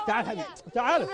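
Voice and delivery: soft coaxing. Real, not acted. Soldier softly coaxing a frightened little boy to come, gentle and hushed. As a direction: coax him over softly so he isn't scared.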